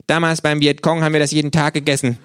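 high, loud cheeky kangaroo greeting. Acted. The kangaroo scolds the late narrator in its high cheeky voice. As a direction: snap it out as the brash kangaroo.

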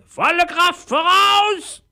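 slow, very high squawked command; shrieking parrot. A narrator's reading. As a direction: screech the order like a parrot. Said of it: "schrie der Papagei". (gekreischtes Papageienkommando, hoch und gedehnt)

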